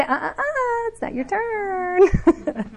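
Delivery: high, slow, laughing mock scold; playful scolding. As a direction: wag a finger at the emotion, half laughing. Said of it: "you say".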